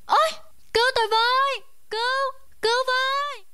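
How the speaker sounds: high terrified cries for help; high desperate cries for help; screaming for help. Acted.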